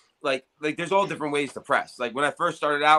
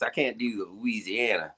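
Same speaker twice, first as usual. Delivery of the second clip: ordinary delivery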